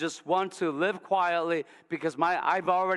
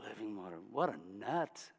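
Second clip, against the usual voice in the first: sarcastic; 6 semitones lower, 9 dB quieter than usual. Acted. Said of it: "She's got some sarcasm".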